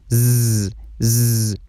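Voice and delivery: "ز" as a sustained buzzing z; voiced z sound. Shown as a demonstration. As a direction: buzz "ز" with no vowel. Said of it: "به شکل زی تلفظ بکنین".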